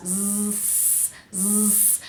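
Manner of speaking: long "Z" buzz turning into a hiss; voice on, then off. Shown as a demonstration. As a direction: hold Z, then switch off the voicing. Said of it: "my voice turns on and then off".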